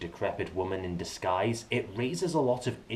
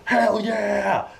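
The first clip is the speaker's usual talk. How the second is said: hell yeah cheer; 10 semitones higher, 6 dB louder than usual. Pretend. Cheering the Sansa and Brienne scene.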